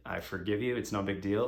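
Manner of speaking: normal talking voice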